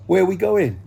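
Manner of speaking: high, loud confused question; clueless young midwife. Retold or imitated. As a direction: ask it lost and high.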